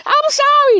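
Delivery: very high cartoonish wail; mock prisoner lament. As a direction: mimic a panicking new convict in falsetto. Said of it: "you motherfuckers going to jail too".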